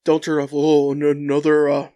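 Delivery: ordinary talk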